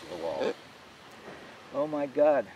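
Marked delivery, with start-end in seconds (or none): 1.7-2.5 louder gasp of astonishment; exclaimed. A narrator's reading. Kirka exclaiming as an opening appears in the wall. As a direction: blurt it out as the wall opens. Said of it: "Kirka exclaimed".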